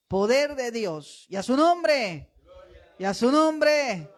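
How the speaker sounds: loud, slow preaching climax; booming praise to God; preaching at full force. Real, not acted. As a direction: lift the church into applause. Preacher crescendo.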